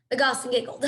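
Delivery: plain reading voice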